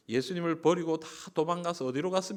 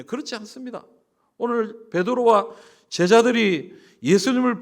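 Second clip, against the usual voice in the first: higher, louder preaching push; fervent preaching; 7 semitones higher, 10 dB louder than usual. Acted. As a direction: rouse the congregation with pathos. Preaching climax.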